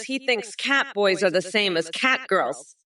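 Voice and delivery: normal talking voice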